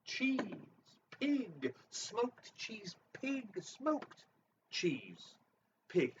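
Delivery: slow, high, softening word by word; drawn-out silly. Acted. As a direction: stretch each word out oddly.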